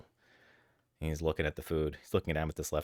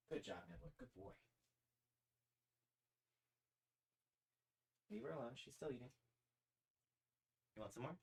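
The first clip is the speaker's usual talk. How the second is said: soft, much higher cooing at the cats; pet talk; 8 semitones higher, 22 dB quieter, faster than usual. Real, not acted. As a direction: coo to your pets softly. Cooing at cats while feeding them.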